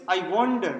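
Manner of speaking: "wander" stressed after a light "I"; unstressed "I" leading into stressed "wander". Shown as a demonstration. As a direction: put the weight on "wander", the second word. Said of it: "My stress is on the second word".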